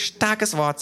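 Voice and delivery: high-pitched approving remark; kangaroo voice. Acted. Kangaroo judging the word, high-pitched. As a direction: comment in a squeaky-high register.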